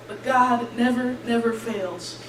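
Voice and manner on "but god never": ordinary talk, nothing marked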